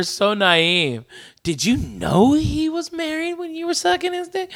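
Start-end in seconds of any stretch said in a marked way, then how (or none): 1.4-4.5 mock-shocked, pitched much higher; mock shocked question. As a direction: squeak it out in exaggerated disbelief. Friend mockingly replays the scene.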